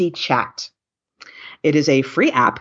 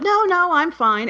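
mock-breezy, much higher denial; brushing off help; 13 semitones higher, slower than usual. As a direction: play her old self waving it away, up high. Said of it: "I would have been like".

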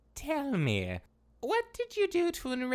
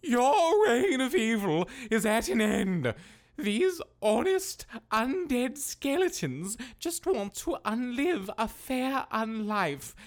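heroic proclamation; 9 semitones higher than usual. Acted. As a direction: proclaim like a hero. Confronting the necromancer heroically.

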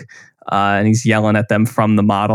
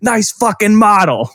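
higher, slow mocking sneer; Beetlejuice impression; 10 semitones higher than usual. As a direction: drawl the insult like a gleeful brat. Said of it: "he kicks it over and he's like".

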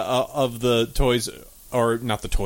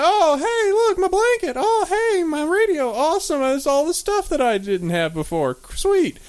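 high, giddy dim-witted voice; dopey delighted master; 13 semitones higher than usual. Retold or imitated. Master finding his old belongings, in a high giddy voice. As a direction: squeal over the found stuff like an idiot.

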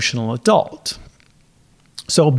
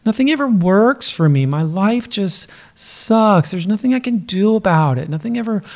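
whiny; 5 semitones higher than usual. Retold or imitated. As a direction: whine like a sulking child. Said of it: "Doesn't this sound whiny?".

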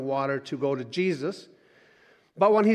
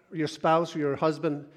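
ordinary talk

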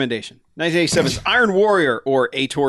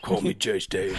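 low, quieter menace; Jason Statham threat; 7 semitones lower, 6 dB quieter than usual. Acted. Action star warning whoever uses his nickname, low mock threat. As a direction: growl a mock tough-guy warning. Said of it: "imagining how much Jason Statham would hurt someone".

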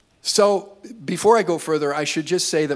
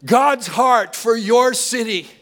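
higher, louder sermon climax; full-force preaching; 8 semitones higher, 4 dB louder than usual. Real, not acted. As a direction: preach it out with rising fervour.

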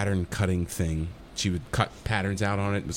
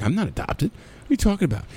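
indignant denial; 8 semitones higher than usual. Retold or imitated. Acting out his indignant denial.

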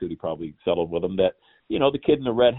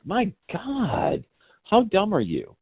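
much higher, exasperated "how dumb are you"; incredulous disbelief; 12 semitones higher, much slower than usual. Retold or imitated. Retelling his reaction to conspiracy posters, high incredulous outburst. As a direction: throw up your hands in mock disbelief. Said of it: "And I'm like".

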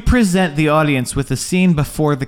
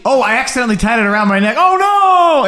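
hammed-up panic voice; mock panic; 7 semitones higher, 4 dB louder than usual. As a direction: fake alarm rising to a yelp. Mock character voice.

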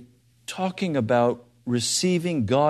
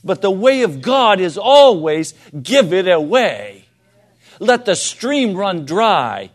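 loud, higher, driving sermon voice; fervent preaching; 4 semitones higher, 7 dB louder than usual. Acted. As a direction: preach it out to the whole hall. Preaching at full force.

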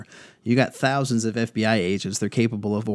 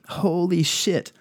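slow, drawn-out holy shit, pitched up; stunned disbelief; 7 semitones higher, slower than usual. Real, not acted. Reacting to foreign-engineered astroturf, stunned and slow. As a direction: let the shock land slowly.

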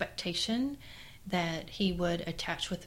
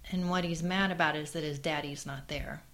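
normal talking voice

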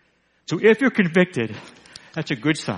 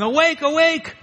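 high-pitched watchman's cry, moderately raised; Mister Conscience yelling alarm; 12 semitones higher, 4 dB louder than usual. Retold or imitated. Preacher voicing the city watchman's warning, high mock yell. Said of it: "Mister Conscience runs around yelling".

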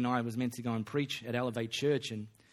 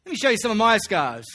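louder, higher, proud showing off; loud eager Paul; 8 semitones higher, 11 dB louder than usual. Pretend. Paul eagerly offering to show the preacher his scars, louder. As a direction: boom it out like a proud veteran.

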